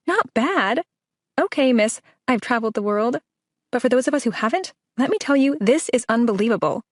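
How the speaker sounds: you can't believe she said not bad; incredulous. A narrator's reading. Avery outraged at understatement.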